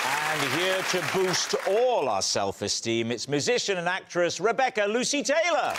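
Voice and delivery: upbeat TV panel-show introduction; show host announcer. Acted. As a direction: lift each guest's name for applause.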